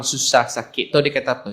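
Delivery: normal talking voice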